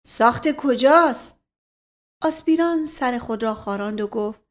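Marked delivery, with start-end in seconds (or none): 0.1-1.4 sharp question. Acted. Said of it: "به تندی پرسید".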